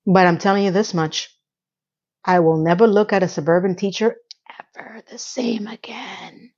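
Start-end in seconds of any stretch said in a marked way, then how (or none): 4.8-6.5 hushed whispered aside; slow scandalised whisper. Real, not acted. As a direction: drop to a slow secret whisper. Dramatic aside after shocking ad.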